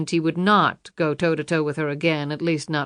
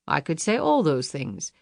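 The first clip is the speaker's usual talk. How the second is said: ordinary delivery, nothing marked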